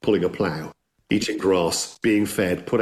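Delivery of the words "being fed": ordinary delivery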